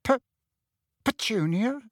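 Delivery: halting, high, tentative question; timid. A narrator's reading. Uncle coaxing his silent wife, timid stammer. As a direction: stammer the name gingerly. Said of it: "said Uncle Vernon timidly".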